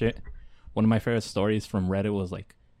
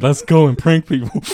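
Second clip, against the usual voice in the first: loud, higher goofy voice; mock hype voice; 5 semitones higher, 11 dB louder than usual. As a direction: ham up the cheerful start. Mock quoting the podcast start.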